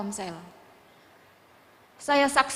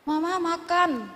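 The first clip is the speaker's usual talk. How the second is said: slow, higher call of a small child, imitated; 6 semitones higher, slower than usual. Sick child asking mama for food, slow drawn-out call. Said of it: "anak saya teriak".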